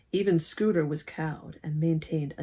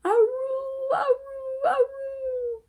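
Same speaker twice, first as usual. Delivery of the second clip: very high dog howling; much slower than usual. Acted. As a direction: howl like a dog joining the ruckus. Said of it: "howled Scooter".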